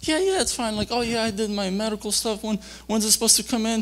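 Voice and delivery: very high, put-on happy voice; faked cheerfulness. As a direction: cover up trouble with breezy chatter for mom. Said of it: "I'm all trying to sound, like, good and happy".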